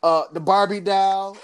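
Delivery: ordinary delivery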